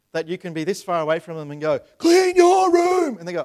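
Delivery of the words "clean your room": a long, drawn-out shout, much higher and louder; parent's yell. Retold or imitated. A parent shouting to the kids to clean their room. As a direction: bellow it across the house at a kid who won't listen.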